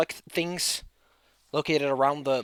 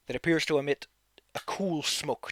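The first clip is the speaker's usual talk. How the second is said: usual speaking voice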